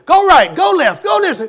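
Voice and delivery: loud, high overlapping orders; nagging directions. Retold or imitated. Mother and sisters shouting directions at the driver. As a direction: bark directions like a backseat driver.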